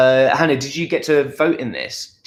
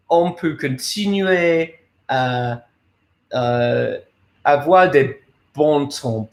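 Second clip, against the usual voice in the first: slow, careful A-level French; schoolbook French; slower than usual. Shown as a demonstration. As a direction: speak French like a proud student showing off. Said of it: "That's A-level French".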